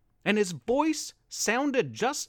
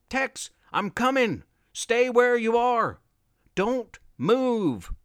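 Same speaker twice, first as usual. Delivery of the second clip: calling out; 5 semitones higher than usual. A narrator's reading. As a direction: call out across the cave. Grandfather calls to Tex.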